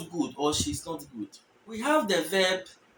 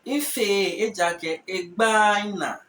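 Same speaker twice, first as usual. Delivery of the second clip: slow model sentence; slower than usual. Shown as a demonstration. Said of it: "a sentence like".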